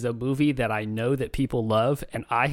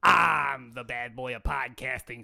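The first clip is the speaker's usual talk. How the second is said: put-on Chicago accent. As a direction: play the bad boy of podcasting, straight from Chicago. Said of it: "daredevil himself from Chicago".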